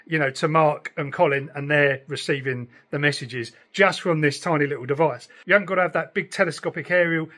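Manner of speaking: usual speaking voice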